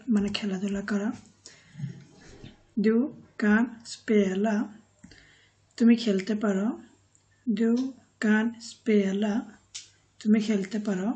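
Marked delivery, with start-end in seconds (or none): 2.8-4.6 kan spela stretched out slowly; slow model phrase. Shown as a demonstration. Teacher demonstrates the phrase slowly to students. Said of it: "teacher models Swedish sentence". 7.5-9.3 kan spela stretched out slowly; slow model phrase. Shown as a demonstration. Teacher demonstrates the phrase slowly to students. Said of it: "teacher models Swedish sentence".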